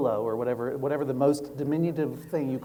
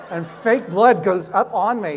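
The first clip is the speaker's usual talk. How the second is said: ordinary delivery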